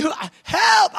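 mock cry for help. Moses imagined crying out.

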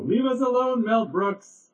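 high mock crowd yell. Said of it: "they all yell".

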